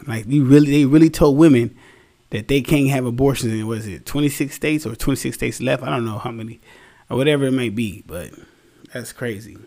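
ordinary talk, nothing marked

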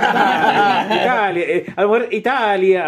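"Italia" with a long stressed TA; Italian-style pronunciation. Shown as a demonstration. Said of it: "no se pronuncia igual en italiano".

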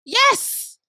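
a high, bright burst of yes; excited yes. Real, not acted. Answering co-host's disbelief about partying till morning, high excited yes. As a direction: fire it back high and thrilled.

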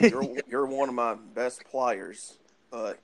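slow, deliberate mock-coach voice, only half landing; a hammed-up stern coach, slowed right down; Nick Saban impression. Imitating the coach talking to his quarterback, slow and put-on. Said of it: "I can imagine Saban being like".

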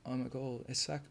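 put-on quiet mumbled Essex accent; soft Essex lad lost in the pub. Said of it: "an Essex accent".

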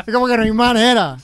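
giggling while admitting she avoids it; laughing. Real, not acted. Guest laughing while telling hosts she avoids the topic.

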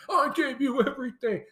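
high, quick indignant cry; imitated angry outburst. Roman berating Sami over the chair, mock-angry. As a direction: throw it at him, fast and high-pitched.

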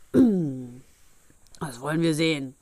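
put-on slow, menacing old giant; old man's challenge. A narrator's reading. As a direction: take up the challenge slowly, in an altered voice. Old monster answers the challenge. (langsam drohender Alter)

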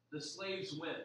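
ordinary talk

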